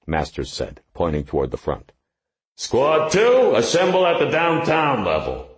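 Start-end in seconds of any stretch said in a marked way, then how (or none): 2.6-5.5 high loud bullhorn orders; through a megaphone. Acted. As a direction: bellow orders over a bullhorn. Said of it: "Masters picked up a bullhorn".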